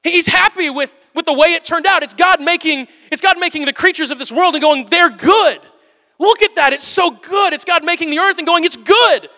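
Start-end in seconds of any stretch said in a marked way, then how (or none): 4.9-7.5 higher, louder, delighted; excited God. Retold or imitated. Preacher voices God thrilled with creation, excited. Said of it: "he is excited about what he's made". 8.6-9.3 higher, louder, delighted; excited God. Retold or imitated. Preacher voices God thrilled with creation, excited. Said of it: "he is excited about what he's made".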